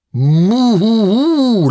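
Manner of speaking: long, high mooing laugh; cow's moo turned into a slow laugh; laughing cows. A narrator's reading. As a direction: moo as if chuckling, drawn out. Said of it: "lachen nun auch die beiden Kühe".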